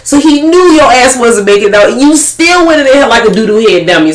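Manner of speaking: high, worked-up scolding; mock scolding. Scolding Mitchell for walking in, high worked-up telling-off.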